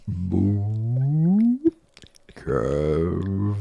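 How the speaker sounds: long whale moan, sliding in pitch; playful put-on whale call; whale accent. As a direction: draw each word out like whale song. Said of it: "can you do your whale accent".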